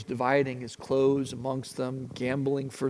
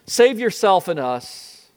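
louder, higher jeer; mock him from the cross; taunting criminal; 5 semitones higher, 9 dB louder than usual. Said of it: "criminal joining in the taunts".

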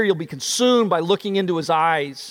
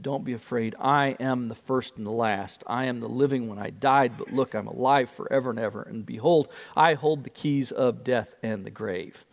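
much softer and lower, tender comfort; gentle reassurance; 7 semitones lower, 6 dB quieter than usual. Acted. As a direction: speak it quietly, like lifting a frightened man. Risen Jesus comforting fallen John.

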